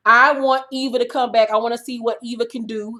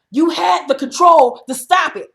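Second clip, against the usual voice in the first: mock accusing shout; 6 semitones higher, 6 dB louder than usual. Retelling Croy's accusation on reunion.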